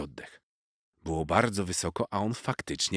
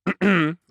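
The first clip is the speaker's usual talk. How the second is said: sharp high throat clearing; 12 semitones higher, 9 dB louder than usual. A narrator's reading. Squirrel clearing her throat to announce herself. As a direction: clear the throat to get attention.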